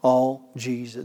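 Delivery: ordinary delivery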